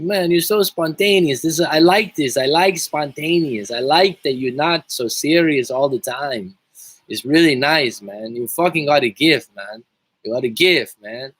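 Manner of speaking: slow, high, lightly put-on South American accent, playful; thick South American accent. Streamer quotes his South American client's praise, in a light South American accent. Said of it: "He's got, like, a really thick accent".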